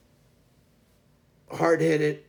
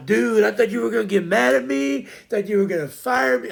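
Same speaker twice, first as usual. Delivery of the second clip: relieved outburst; 7 semitones higher, 5 dB louder than usual. Retold or imitated. Employee relieved after mistake.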